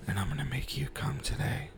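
high breathy seductive whisper; sultry whisper. Pretend. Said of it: "You do have a sultry voice".

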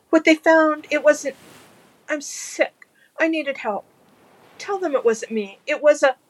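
high, desperate begging; panicked pleading. Acted. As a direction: beg with rising panic.

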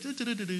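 imitating buddy's walking sounds; mock walking sound effect. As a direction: hum out his footsteps like a buddy. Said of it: "he'd be like".

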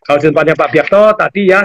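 normal talking voice

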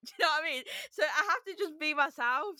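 laughing. Real, not acted. Laughing while saying it.

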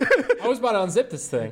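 laughing. Real, not acted. Laughing about the onesie.

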